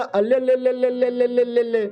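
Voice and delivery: high, monotone run of babble; comic mystic's nonsense chant; fortune teller. Acted. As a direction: rattle out syllables on one held pitch. Fortune teller's entrance gibberish.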